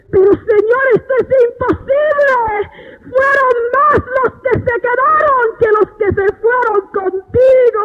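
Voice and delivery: high-pitched tearful plea; anguished protest. Retold or imitated. Woman pleads with the Lord about those left behind, tearful high protest. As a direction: protest to God in a reenacted wail.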